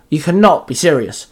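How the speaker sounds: ordinary delivery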